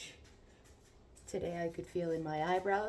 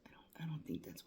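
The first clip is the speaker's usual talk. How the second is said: whispering; 15 dB quieter than usual. Real, not acted. Unsure, muttering to herself.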